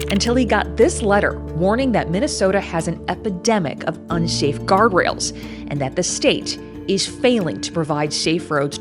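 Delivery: steady reporter voiceover; measured, serious news read; investigative news exposé. Acted.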